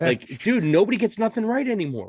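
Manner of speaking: high, loud exasperated rant. Real, not acted. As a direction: throw your hands up at everyone getting it wrong. Ranting about bad orders.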